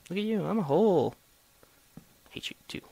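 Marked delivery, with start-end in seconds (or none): 2.3-2.8 unvoiced, much softer; whispered grudge. Real, not acted. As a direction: hiss it under your breath.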